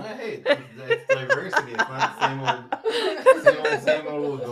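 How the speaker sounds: high squeaky laugh through words. Real, not acted. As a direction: giggle it out, high. Joking sarcastically, laughing.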